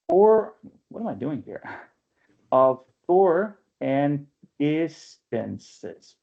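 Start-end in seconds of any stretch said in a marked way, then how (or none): 2.5-6.1 Of tour and distances drawn out, tour weighed; slow scansion read. Shown as a demonstration. Teacher testing the meter aloud, slow. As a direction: sound out the line slowly to test the stress. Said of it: "I would argue".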